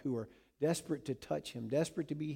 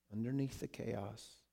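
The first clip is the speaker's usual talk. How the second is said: usual speaking voice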